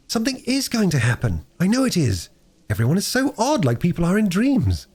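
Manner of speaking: put-on a girl sensing something wonderful; excited young girl. Bobby speaks to herself.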